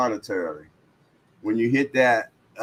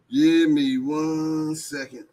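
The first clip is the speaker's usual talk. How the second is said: slow, stretched out and higher; drawn-out repeat; 6 semitones higher, slower than usual. Acted. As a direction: drag each word out lazily. Repeats line while typing link.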